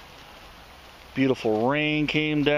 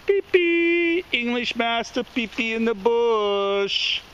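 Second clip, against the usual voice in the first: pet talk; 7 semitones higher than usual. Real, not acted. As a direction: coo at the dog about her pee. Talking to the dog.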